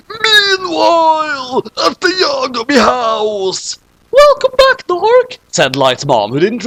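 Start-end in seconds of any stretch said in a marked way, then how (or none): 0.1-3.8 slow, high shouted announcer; dramatic announcement. Acted. Narrator announcing the scene change, slow high shout. 4.1-5.4 much higher and louder squeal; high loud mom greeting. Acted. Light's mom welcoming Dark home, high and loud.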